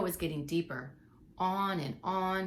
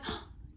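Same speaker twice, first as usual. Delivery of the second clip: soft breathy gasp of delight; 8 dB quieter than usual. Acted. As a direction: gasp at the surprise nest. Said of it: "gasped with delight".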